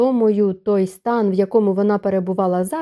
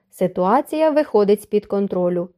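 plain reading voice

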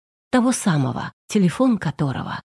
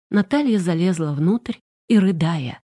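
plain reading voice

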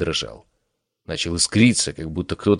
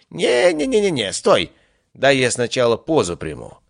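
squealing high protest of a vain god; 6 semitones higher than usual. Acted. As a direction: stop him, fussy and theatrical. God stops bowing.

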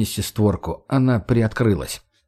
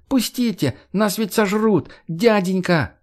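high frantic wail; wailing plea; 9 semitones higher, slower than usual. Acted. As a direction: beg at the window in mock panic. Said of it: "заголосил он". (испуганно голосит)

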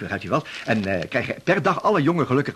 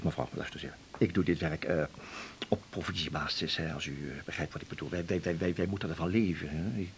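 low, soft self-pitying plea; pitiful pleading; 8 semitones lower, 12 dB quieter than usual. Acted. Salesman begging the housewife for pity, soft and low. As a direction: whine for sympathy quietly.

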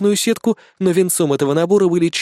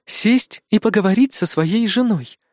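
disapproving; 6 semitones higher, slower than usual. A narrator's reading. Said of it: "осуждающе покачав шлемом".

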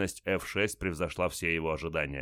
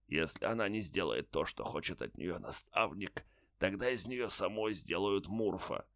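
faint deathbed whisper; 6 dB quieter than usual. A narrator's reading. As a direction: breathe it out like a dying man. Dying soldier continues.

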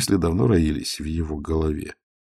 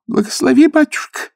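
much higher, loud wail; pilgrim woman wailing at stoker's feet; wailing plea; 7 dB louder than usual. Acted. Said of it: "заголосила женщина".

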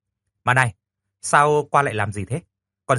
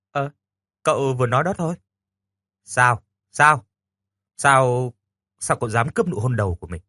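usual speaking voice

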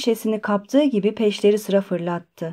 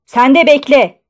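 loud, raised-pitch order; shouted rebuke; 4 semitones higher, 8 dB louder than usual. A narrator's reading. Postmaster to a woman at the counter, shouting. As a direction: yell at someone to wait. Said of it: "diye bağırdı".

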